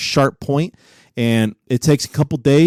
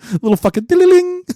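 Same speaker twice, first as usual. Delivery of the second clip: goofy high-pitched squeak; squeaky high joke voice; faster than usual. Retold or imitated. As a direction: jump way up high and fast for the joke. Joking about tooth necklace.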